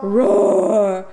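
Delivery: loud playful roar; lion roar. Pretend. Mom roaring back at her toddler like a lion.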